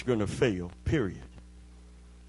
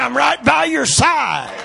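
high, loud shouted promise in one run; climactic preaching shout; 12 semitones higher, 10 dB louder, slower than usual. Acted. Preacher voicing God's promise to the congregation, climactic shout. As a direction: belt it out at the peak of the sermon.

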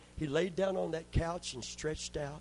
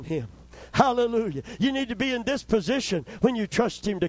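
higher and louder, driving preacher's call to the church; fiery preaching; 5 semitones higher, 5 dB louder than usual. Acted. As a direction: push the congregation hard, like a revival preacher. Preaching at full force.

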